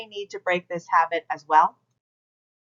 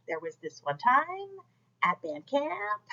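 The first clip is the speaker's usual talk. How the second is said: put-on valley girl uptalk, softer, each phrase lifting into a question; 3 semitones higher, 7 dB quieter than usual. Shown as a demonstration. Teacher demonstrating a common pitch problem, valley girl. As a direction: turn the statement into questions, valley-girl style. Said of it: "sounding like a valley girl".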